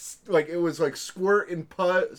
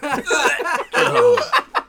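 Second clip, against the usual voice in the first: loud laugh; 10 dB louder than usual. Real, not acted. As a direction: burst of high loud laughter. Friends laughing at gross story.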